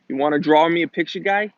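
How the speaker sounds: quick imitation of New England talk, rising on "guy"; New England accent. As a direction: rattle it off like a New England local. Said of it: "they always end the sentences with guy".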